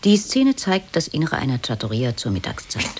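usual speaking voice

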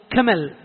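"كمل" with k replacing the jim of جمل; jim as kaf. Shown as a demonstration. Teacher showing a dialect form of جمل, "كمل" with initial k. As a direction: start "كمل" on a hard k, not g. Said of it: "من يبدل الجيم كاف".

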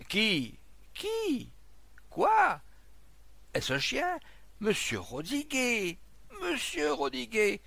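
high, startled shouts; urgent, high-pitched calls; crowd calling out. Acted. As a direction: call across a crowd in alarm. Crowd questions and calls the man.

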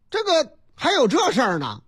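usual speaking voice

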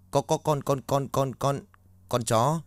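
Mạnh stammering to the guard in fright; terrified stammer. Acted. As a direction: trip over every word in fear.

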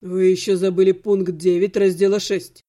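cold, low correction. A narrator's reading. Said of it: "сказал некромант холодно". (холодно, низким голосом)

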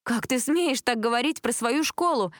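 high-pitched, slow outraged reproach; indignant. A narrator's reading. Cheer captain to a classmate insulting the team, indignant. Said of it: "возмутилась Джессика".

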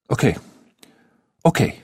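hesitant, halting okay with a gap; stammered. A narrator's reading. Boy suddenly unsure of himself, mildly stammering. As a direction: falter between the two words. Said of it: "stotterte er".

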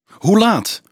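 high, loud, insistent question; agitated demand; urgent questioning. A narrator's reading. Urgent questioning about the find.

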